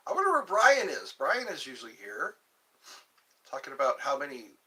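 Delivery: ordinary talk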